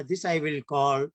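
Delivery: ordinary talk, nothing marked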